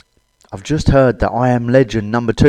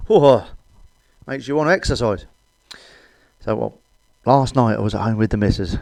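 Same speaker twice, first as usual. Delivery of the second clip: usual speaking voice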